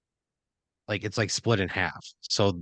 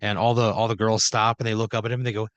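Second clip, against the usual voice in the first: ordinary talk, nothing marked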